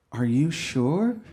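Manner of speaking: drawn-out disbelief with a laugh; doubtful, slow "Are you sure?". Retold or imitated. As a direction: rise up, skeptical, slowly. Fiancée's doubtful reply.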